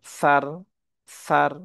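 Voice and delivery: সার with a soft sa, not hard; gentle tha-like sa. Shown as a demonstration. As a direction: keep the sa of সার light and soft. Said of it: "সায়ের উচ্চারণটা নরম হবে".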